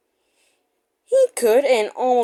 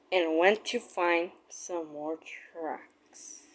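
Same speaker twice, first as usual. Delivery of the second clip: ordinary delivery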